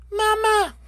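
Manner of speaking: very high, piping chick call; baby gosling cry. Acted. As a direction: squeak it like a newborn gosling. Goslings following the bear.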